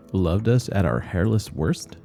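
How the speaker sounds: ordinary delivery, nothing marked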